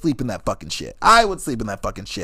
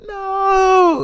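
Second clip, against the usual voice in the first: high crying wail no; high sobbing no; mock wail; 6 dB louder than usual. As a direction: wail like a child. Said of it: "I'm like".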